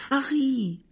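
high-pitched, startled exclamation; small shrill voice. A narrator's reading. Hermione suddenly seeing Harry, small shrill exclamation. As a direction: cry out in a tiny, very high voice. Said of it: "s'exclama Hermione d'une petite voix aiguë".

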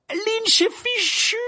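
high, slow wailing shout; dismayed drawn-out cry. A narrator's reading. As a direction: wail that all is lost. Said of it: "s'écria Harry".

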